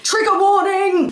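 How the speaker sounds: loud, high, drawn-out cry; shouted warning. Real, not acted. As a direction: call it out loud. Gag warning while brushing.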